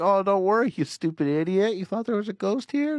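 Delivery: high-pitched, exaggerated ridicule; mock dismissive scoffing. Man hiding fear from girlfriend, hammed-up high scoffing. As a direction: laugh off the fear with put-on scorn.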